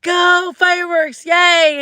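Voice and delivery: put-on high, loud kid cheer; cheering girl. Retelling a small fan cheering at the fireworks, high and loud. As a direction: cheer like an excited little girl. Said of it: "She's like".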